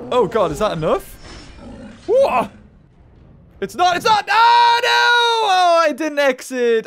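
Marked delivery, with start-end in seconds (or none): 2.0-2.5 loud, very high yelp; shocked disbelief. Real, not acted. Attack falls short in game. 3.6-6.8 high, loud wail of defeat; anguished shout. Real, not acted. Realizes the battle is lost.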